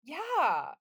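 usual speaking voice

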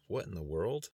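understated astonishment, rising at the end; mildly taken aback, can't believe it; incredulous rising question; disbelief. A narrator's reading. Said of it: "couldn't believe his eyes".

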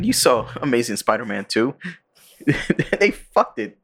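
ordinary talk, nothing marked

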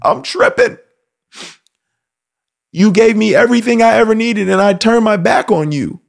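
high pleading younger son; repentant son. Retold or imitated. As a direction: beg your father, voice lifted high. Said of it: "he's like".

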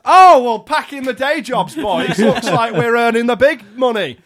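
high, loud astonished cry; mock amazement. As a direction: fake delight at tiny money. Mock shock at earnings.

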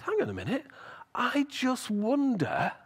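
dawning realisation. Acted. Eli suddenly suspects God is speaking, wondering aloud.

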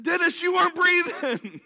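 high, loud laugh through the words; laughing while retelling. Retold or imitated. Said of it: "I said".

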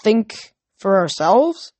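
very slow, questioning rise; doubtful echo. A narrator's reading. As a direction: echo it back slowly, unsure. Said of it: "one kid echoed".

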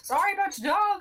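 high, squeaky goblin apology; goblin character voice. Acted. Chuff addresses Vorka after killing her dog, squeaky goblin voice. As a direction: pitch it way up, in character. Player speaks as Chuff to villain.

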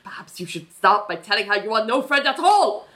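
mock German radio-doctor voice; German accent. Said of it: "Doctor Ruth is German".

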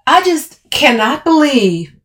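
loud, high, slow disbelief; indignant last word. A narrator's reading. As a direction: launch the last word slowly, outraged. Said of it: "needing the last word".

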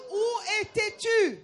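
high, slow mock shout; retold exclamation. Interpreter relaying a visitor's question, acted exclamation. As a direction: re-enact an amazed cry, drawn out.

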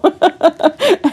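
bursting into high laughter; laughing. Real, not acted. Laughing at her own Holy Spirit voice, high laugh.

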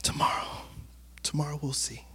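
hushed, unvoiced tomorrow we'll see; humble whisper. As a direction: breathe it like a meek promise. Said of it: "He was humble and he was like".

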